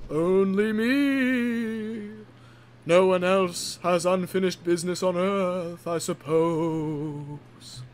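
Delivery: very slow, wailing ghost. Acted. Ghost of Diesel answering the frightened killer, slow ghostly wail. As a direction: draw every word out eerily. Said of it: "said the ghost of Diesel".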